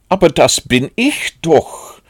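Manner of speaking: high, loud astonished protest; utterly astonished. A narrator's reading. Pooh tells Rabbit that he is Pooh, utterly astonished. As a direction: protest in high-pitched amazement. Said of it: "sagte Bär überaus erstaunt".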